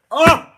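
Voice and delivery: sharp high scream of surprise; startled shriek. Real, not acted. Reader startled mid-reading, sudden high yelp.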